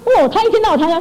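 ordinary delivery

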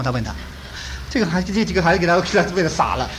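ordinary talk, nothing marked

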